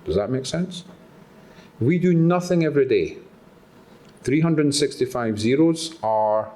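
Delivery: normal talking voice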